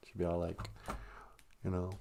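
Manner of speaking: normal talking voice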